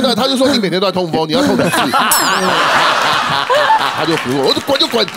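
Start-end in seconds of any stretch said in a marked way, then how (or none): 1.8-3.9 long laugh at own joke; amused laugh. Real, not acted. (开心地笑)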